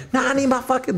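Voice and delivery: high-pitched mock female demand; demanding dancer. Dancer demanding her money, high and insistent.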